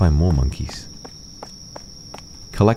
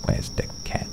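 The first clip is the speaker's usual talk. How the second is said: whisper; 11 dB quieter than usual. Real, not acted. Sneaking around.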